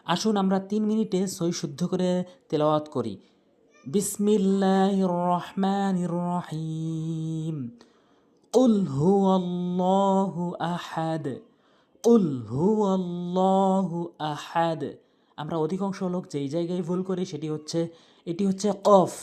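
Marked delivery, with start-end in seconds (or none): 3.9-14.8 slow, measured Arabic recitation with long held vowels; Quran recitation. Shown as a demonstration. Teacher reciting the sura correctly for learners, slow recitation. 18.8-19.1 "কফ" with a deep back-of-throat q, punched louder; deep qaf sound. Shown as a demonstration. Teacher naming the correct letter, deep throaty qaf. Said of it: "গলার মাঝখান থেকে উচ্চারণ হবে".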